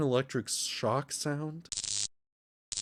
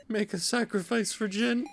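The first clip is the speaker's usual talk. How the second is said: high, soft, troubled question; small high character voice; distressed young character; 7 semitones higher, 4 dB quieter than usual. Acted. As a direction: ask it high and worried.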